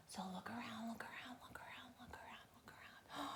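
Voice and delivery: repeated hushed look around, fading; whisper. Shown as a demonstration. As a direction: coax the kids to peer about, whispering. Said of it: "start looking for some food".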